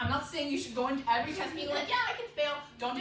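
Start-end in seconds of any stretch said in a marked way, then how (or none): 1.9-2.5 mock carefree. Mimics carefree attitude before a test.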